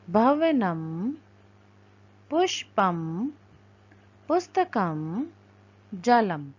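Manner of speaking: each word said slowly and clearly, a pause after each; careful Sanskrit pronunciation. Shown as a demonstration. Teacher reading words aloud for students, slow and clear. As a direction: model each word for the class to repeat. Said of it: "उनका हमें उच्चारण करना है".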